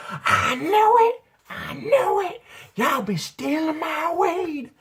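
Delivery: very high, drawn-out screechy wail; outraged grandma. Retold or imitated. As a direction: imitate grandma shrieking an accusation. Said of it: "She's like".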